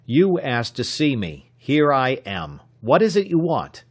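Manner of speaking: impatient, rising to a sharp demand; irritated. A narrator's reading. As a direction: press him, annoyed and curt. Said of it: "Tom snapped".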